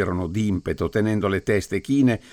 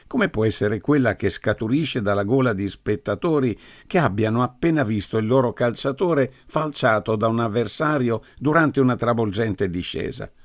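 plain reading voice

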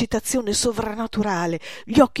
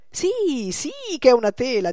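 put-on eager old painter; 6 semitones higher, 5 dB louder than usual. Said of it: "diceva Frenofer".

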